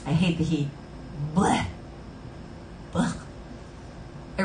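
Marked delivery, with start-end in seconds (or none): 1.3-1.6 short blech of distaste; disgusted blech. Real, not acted. Grumbling to viewers about hating the heat, disgusted blech. 2.9-3.2 short, choked-off blech; a quick blurt of revulsion; disgusted blech. Real, not acted. Repeating the complaint about the heat, disgusted blech.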